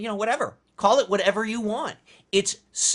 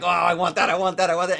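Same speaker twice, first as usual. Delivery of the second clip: greedy hungry brain; 5 dB louder, faster than usual. Retold or imitated. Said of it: "your brain has a certain, you know, like".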